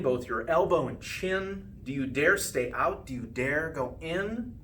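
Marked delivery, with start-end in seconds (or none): none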